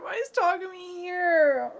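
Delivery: high wail of disbelief; dismayed outcry. Real, not acted. Reader wailing as the hated character appears. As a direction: groan that he is back.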